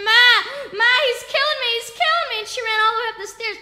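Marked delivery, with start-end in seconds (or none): 0.0-2.4 high, loud cries for help; screaming. Retold or imitated. Beaten child calls to Ma for rescue, screaming. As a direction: call out louder and higher with each plea. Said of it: "And I'm screaming".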